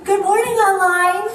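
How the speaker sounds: high, loud, laughing hello; bright greeting. Real, not acted. Pastor greeting the online viewers, loud and laughing. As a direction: greet the viewers with a big lift.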